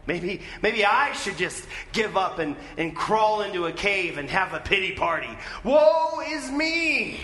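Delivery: mock lament rising to a high, loud wail; hammed-up self-pity. Preacher voicing anyone wanting to give up, mock self-pity. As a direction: wallow theatrically, building to a long cry.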